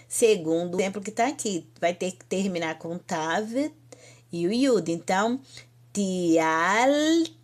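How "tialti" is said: "tialti" with a long held "al", then "ti"; drawn-out syllables. Shown as a demonstration. Teacher modelling a new verb form, drawn-out syllables. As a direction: stretch "tialti", pausing before the last syllable. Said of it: "Então, vai ficar tial".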